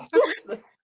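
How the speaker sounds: high, laughing who is James; a laughing, high-pitched mock-puzzled question; laughing mock confusion. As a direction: giggle through the question. Echo, laughing.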